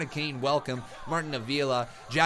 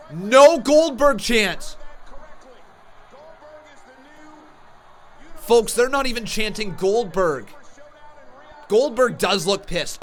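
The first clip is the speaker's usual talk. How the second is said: much higher and louder, slowed call; excited live reaction; 9 semitones higher, 10 dB louder than usual. Real, not acted. Streamer reacting to the arena crowd, excited shouting.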